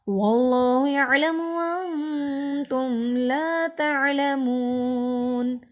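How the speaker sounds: slow melodic Quranic chanting; Quran recitation. Acted. Teacher reciting the whole verse fluently for students, melodic chant.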